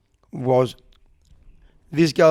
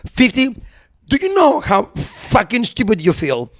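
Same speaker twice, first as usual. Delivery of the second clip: exasperated rant; 7 semitones higher, 3 dB louder than usual. Real, not acted. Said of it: "I hated myself".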